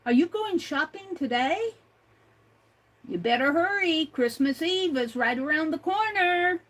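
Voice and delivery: ordinary talk, nothing marked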